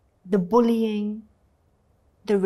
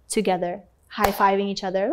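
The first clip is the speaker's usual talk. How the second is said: ordinary talk, nothing marked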